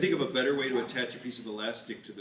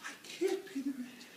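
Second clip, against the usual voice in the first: damsel in distress. Pretend. Damsel pleads again.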